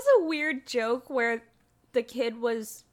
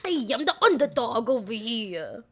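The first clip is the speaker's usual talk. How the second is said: mock wise-guy talking dog; Underdog; 3 semitones lower than usual. Friend redoes the dog in his Joker manner, hammed-up wise-guy voice. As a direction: swagger through it like a cartoon wise guy. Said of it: "that voice he just made for Underdog".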